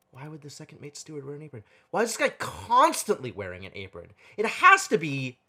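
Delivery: ordinary delivery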